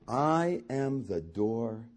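low, slow solemn declaration; Jesus declaring himself to the crowd, slow and solemn. Acted. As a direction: deliver it slowly and low as a revelation.

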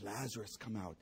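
soft, low, gentle call; quiet call. Acted. Preacher replaying Jesus's call softly to the crowd. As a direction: say it almost under the breath. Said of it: "He says".

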